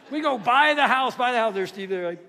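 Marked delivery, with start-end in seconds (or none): 0.4-1.5 loud, punchy repeated chant; mimicked hollering. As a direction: holler it out, imitating someone. Mimicking excited people aboard boat.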